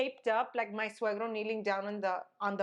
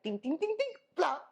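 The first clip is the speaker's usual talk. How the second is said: higher-pitched tumbling sound effects; imitated tumble; 7 semitones higher than usual. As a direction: rattle off dings, then a splat.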